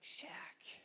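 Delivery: breathless whispered name; stunned whisper. Retold or imitated. Nick reacting to Shaq denying dinosaurs, shocked whisper. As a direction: whisper it in disbelief.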